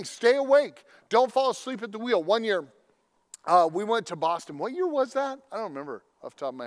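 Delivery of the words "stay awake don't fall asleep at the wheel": raised and emphatic; urgent exhortation. Acted. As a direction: drive the warning home. Preacher repeating key exhortation.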